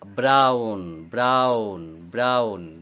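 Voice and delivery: "Brown" stretched out slowly, pitched low; slow model pronunciation. Shown as a demonstration. Teacher shows pupil the word, "brown" drawn out slowly. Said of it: "দেখো".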